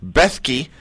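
Bethke said as [name] plus Key; Bethke, said like [name] plus Key, no Y sound; spelled-out pronunciation. Shown as a demonstration. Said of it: "[name] is in the song that [name] sang, and Key".

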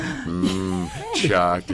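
low, drooling cartoon-dad moan; Homer Simpson. Putting a cartoon quote on the chocolate, low dopey drool. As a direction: savour the word in a low, slow groan. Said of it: "gotta quote Homer Simpson".

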